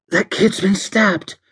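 high-pitched alarmed outburst. Acted. Alicia spots a stabbed boy, alarmed.

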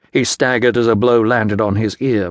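plain reading voice

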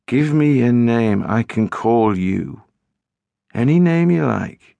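a faint hint of the stranger on the phone; boy's voice. A narrator's reading. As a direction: narrator voicing a strange boy gently asking her name. Boy on the phone.

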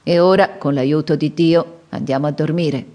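ordinary delivery, nothing marked

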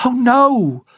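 high, loud quick no; vehement denial. A narrator's reading. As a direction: deny it hard. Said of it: "denied vehemently".

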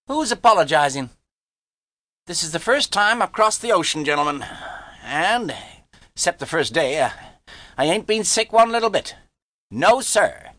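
put-on high, indignant young braggart; boastful boy. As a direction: protest like a cocky youngster. Harvey protests and boasts.

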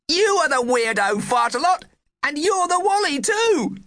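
high shrill shriek. A narrator's reading. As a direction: shriek it at the head teacher. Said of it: "and she shrieked".

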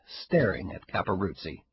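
ordinary delivery

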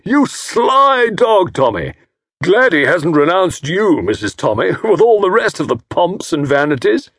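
loud, high, jovial teasing; hearty old fellow. A narrator's reading. Jovial host teasing Tommy about his wife, hearty. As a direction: slap him on the back with it.

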